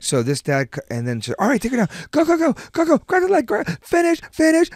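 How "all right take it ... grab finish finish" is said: high-pitched, frantic imitated coaching yell; mock screaming dad. Imitating the dad coaching his girl, high-pitched screaming. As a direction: pile up urgent orders as a screaming dad. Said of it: "And he's screaming".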